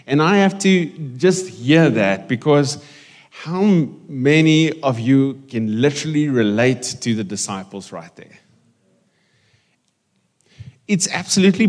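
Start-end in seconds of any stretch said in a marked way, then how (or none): none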